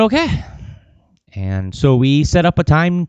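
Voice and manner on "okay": high bright rising okay; eager okay. Retold or imitated. Agreeing to interview, eager high-pitched okay. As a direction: jump at the chance. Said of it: "And I said".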